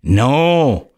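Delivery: dry, mocking "No"; flat sarcastic dismissal. Engineers dismiss the civil engineer's claim, sarcastically.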